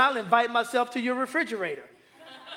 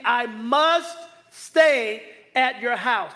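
slow, louder call to repeat; congregation echo; 4 dB louder, much slower than usual. Shown as a demonstration. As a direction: lead them slowly, stress must. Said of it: "Everybody say that with me".

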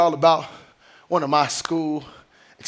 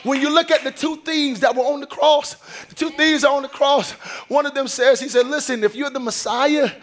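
full preaching; 7 semitones higher than usual. Acted. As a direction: preach it to the congregation, building.

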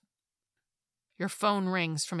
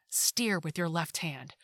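usual speaking voice

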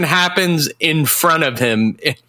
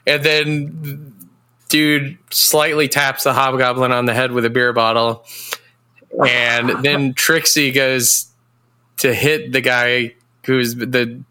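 usual speaking voice

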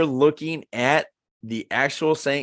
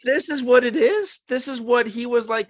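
higher, incredulous questions; disbelief; 9 semitones higher than usual. Retold or imitated. Watching the movie and venting to himself, incredulous. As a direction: sound let down and baffled.

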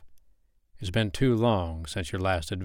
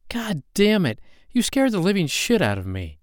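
much higher, louder frightened scolding; scared angry outburst; 11 semitones higher, 5 dB louder than usual. Acted. Woman scolding the gunman who scared her, shaken shout. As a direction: let the fright spill into anger.

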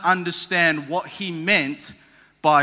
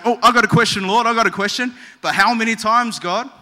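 higher, louder, eager interruption; eager Peter; 5 semitones higher, 5 dB louder, faster than usual. Acted. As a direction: burst in with an urgent question. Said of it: "Peter's like".

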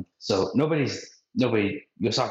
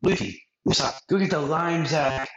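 lightly voiced bossy Nami, pitched up; hint of Nami giving orders; 7 semitones higher than usual. Nami ordering the crew, a bit higher. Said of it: "Nami's like".

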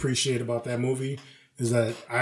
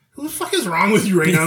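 incredulous retort; 6 semitones higher, 6 dB louder than usual. Retold or imitated.

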